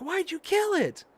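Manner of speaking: mock outrage in a very high voice; upset. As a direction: complain like she's upset with me. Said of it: "she gets upset with me".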